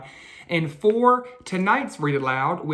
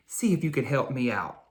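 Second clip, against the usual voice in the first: usual speaking voice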